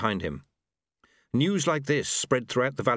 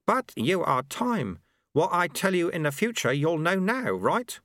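higher, questioning sweeper voice; sweeper character, insistent; 8 semitones higher than usual. Acted. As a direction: press the point in a raised character voice.

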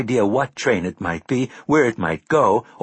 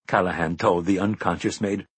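ordinary delivery

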